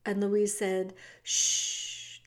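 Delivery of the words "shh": long soothing shush; hushing. A narrator's reading. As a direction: hush someone with a breathy "shh". Louise hushing the wailing cook.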